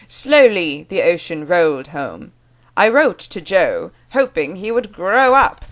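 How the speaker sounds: slow, loud, overdone British accent; hammed-up British drill sentences. Shown as a demonstration. Vlogger parodying the book's practice sentences, hammed-up British. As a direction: recite like a pompous accent tape, slowly. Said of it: "has you use it in a sentence".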